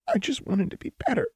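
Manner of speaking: high, pleading, tearful voice; tearful plea. A narrator's reading. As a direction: beg to be understood.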